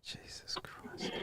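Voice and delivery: whispered, slow and weary; exasperated whisper. Real, not acted. Streamer reacting to the clip alone, a weary whisper. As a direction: breathe it out in disbelief. Exasperated aside after long pause.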